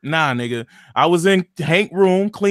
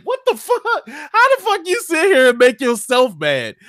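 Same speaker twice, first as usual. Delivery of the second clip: 1 incredulous outburst; 8 semitones higher than usual. Retold or imitated. Mocking Todd's self-made rage.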